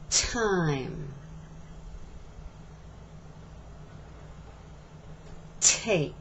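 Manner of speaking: "Two", "Ten", "Time", "Take" with a clear puff on the t; slow model words, breath on each t; aspirated t words. Shown as a demonstration. Teacher modeling t words for students to repeat. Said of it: "Let's use the T sound in words first".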